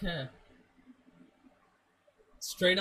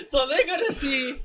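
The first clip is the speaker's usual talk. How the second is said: laughing; 8 semitones higher than usual. Real, not acted. Laughs about cousins seeing hair.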